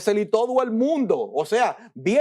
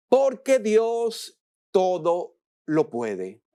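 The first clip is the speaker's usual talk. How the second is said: ordinary talk, nothing marked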